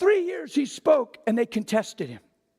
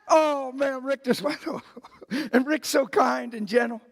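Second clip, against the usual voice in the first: usual speaking voice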